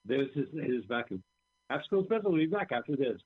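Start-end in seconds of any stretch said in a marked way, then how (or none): 1.7-3.2 bright announcer break voice. Pretend. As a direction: read it like a TV promo.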